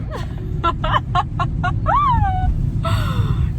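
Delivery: squealing high laugh. Real, not acted. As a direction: burst into a giggle. Laughs at her own claim. (yüksek perdeden kahkaha)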